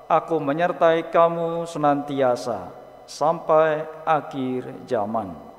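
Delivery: normal talking voice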